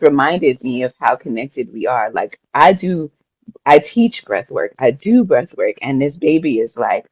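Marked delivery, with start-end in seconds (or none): none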